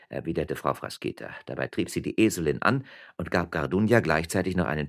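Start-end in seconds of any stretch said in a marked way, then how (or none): none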